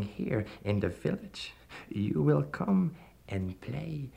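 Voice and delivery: slow, silky devil voice; lightly voiced sinister stranger. A narrator's reading. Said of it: "he said".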